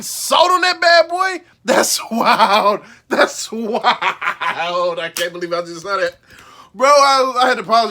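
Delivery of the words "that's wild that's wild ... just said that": laughing through the words. Real, not acted. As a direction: crack up while saying it. Laughing at the clip.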